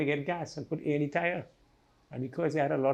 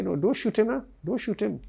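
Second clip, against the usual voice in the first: high, lightly voiced objection; imitating second attacker; 7 semitones higher than usual. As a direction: mimic the other man saying no, high. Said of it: "somebody else say".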